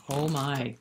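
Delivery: ordinary delivery, nothing marked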